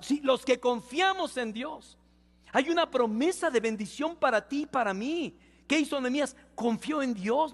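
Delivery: high, emphatic proclamation; fervent preaching. Real, not acted. Pastor tells the church of a promise for you and me, fervent high preaching. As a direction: drive the promise home to the congregation.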